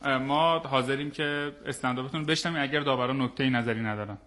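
usual speaking voice

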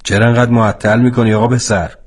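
put-on gruff driver hurrying the boy; impatient driver. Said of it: "راننده گفت".

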